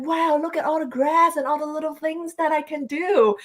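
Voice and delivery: high, wide-eyed retold gasp of discovery; childlike wonder. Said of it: "I was like".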